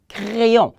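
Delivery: "Crayon" with the R vibrated in the throat; French guttural R. Shown as a demonstration. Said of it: "The vibration of the R".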